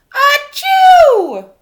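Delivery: loud high sneeze; big sneeze. Acted. Elephant Gerald sneezing at Piggy, huge sneeze. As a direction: let the sneeze explode.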